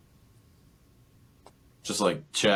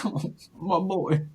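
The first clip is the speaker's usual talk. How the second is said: high, cooing tenderness; doting sympathy; 11 semitones higher than usual. Real, not acted. Fan reacting to Kong almost tearing up, high doting coo. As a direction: coo it like a moved parent. Gushing over tearful Kong.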